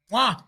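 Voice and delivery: big appreciative air kiss; exaggerated kiss noise for delicious food; chef's kiss. Real, not acted. Kisses the air over food.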